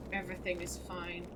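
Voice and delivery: soft, fast mumbled "Everything is fine"; nervous self-soothing murmur. Real, not acted. Scared player calming self, quiet. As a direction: reassure yourself under your breath. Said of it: "I'm fine".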